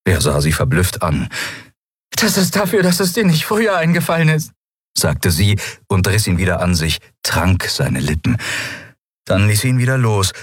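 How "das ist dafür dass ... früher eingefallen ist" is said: high, scolding woman's voice; a heroine scolding between slaps; Endriel's voice. Acted. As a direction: raise the pitch and scold him half-laughing. Endriel scolds Kai while slapping.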